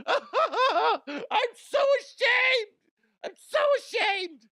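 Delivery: very high, loud, slow wailing shout; over-the-top mock despair; hammed-up wail. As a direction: wail the shame like a wrestler. Wailing Macho Man shame.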